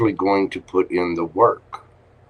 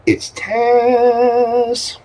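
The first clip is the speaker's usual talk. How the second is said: high sing-song with a hissing Tesss; drawn-out s like a snake; 6 dB louder than usual. Acted. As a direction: stretch the s of Tess like a snake. Greeting the caller by name.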